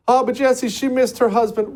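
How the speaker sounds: high-pitched mocking protest; mock objector. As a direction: put on a high, pleading objector's voice.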